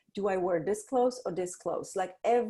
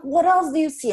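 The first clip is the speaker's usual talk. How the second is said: eager question; 10 semitones higher, 10 dB louder than usual. Retold or imitated. Ideal parent answering child.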